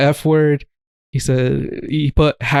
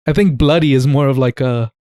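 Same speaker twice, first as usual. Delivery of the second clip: ordinary talk, nothing marked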